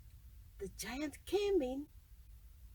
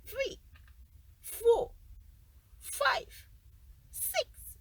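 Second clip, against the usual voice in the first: greedy giant counting gold, climbing higher; each number pitched higher and gleeful; 8 semitones higher, slower than usual. Acted. Giant counting his gold coins.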